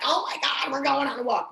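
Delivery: high, thrilled dog voice; squealing over a walk; excited dog. Retold or imitated. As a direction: gush like a dog that sees its leash. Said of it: "They're like".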